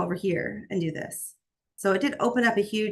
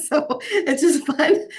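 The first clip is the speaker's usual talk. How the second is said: laughing; 8 semitones higher, 4 dB louder, slower than usual. Real, not acted. Laughs at own joke.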